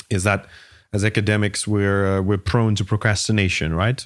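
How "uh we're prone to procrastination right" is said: usual speaking voice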